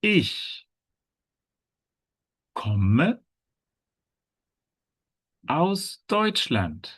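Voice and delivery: very long pauses between ICH, KOMME and AUS DEUTSCHLAND; feed learners the sentence piece by piece; high ICH, pause, KOMME, pause, slow AUS DEUTSCHLAND; word-by-word model. Shown as a demonstration. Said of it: "how does each character introduce".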